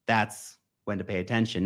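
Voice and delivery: usual speaking voice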